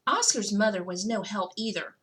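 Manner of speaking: usual speaking voice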